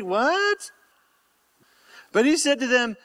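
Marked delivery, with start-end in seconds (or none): 0.0-0.7 high, incredulous little yelp; mock disbelief. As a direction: play a disciple balking in disbelief. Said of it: "They're all".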